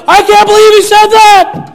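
high, loud, shocked whine; imitating the shocked media; mock outrage. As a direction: ham up scandalized media voices. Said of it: "Everyone's like".

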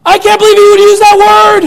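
high, loud, shocked whine; imitating the shocked media; mock outrage. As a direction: ham up scandalized media voices. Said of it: "Everyone's like".

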